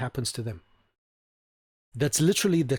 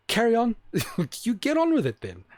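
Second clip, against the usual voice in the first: mock breezy encouragement; 7 semitones higher than usual. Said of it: "then you would say to her".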